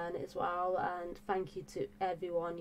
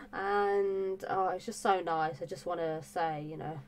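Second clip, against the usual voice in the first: normal talking voice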